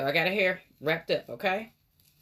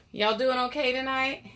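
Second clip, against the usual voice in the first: usual speaking voice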